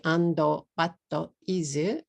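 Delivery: "ando, batto, izu" each in two even beats; Japanese-style AND, BUT, IS with added final vowels; katakana English. Shown as a demonstration. Teacher warning about speaking abroad, katakana English. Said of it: "katakana English".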